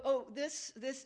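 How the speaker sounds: ordinary talk, nothing marked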